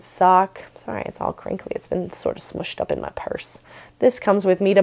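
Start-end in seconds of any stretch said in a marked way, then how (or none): 0.8-3.6 whispered aside. Real, not acted. Quiet apologetic aside.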